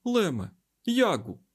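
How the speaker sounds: high, urgent call; worried. A narrator's reading. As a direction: call their names with concern. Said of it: "промовив він стурбовано".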